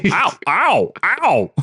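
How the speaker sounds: high, loud hammed-up ows; mock pained yelps. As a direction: yelp like you're being grabbed. Character getting grabbed.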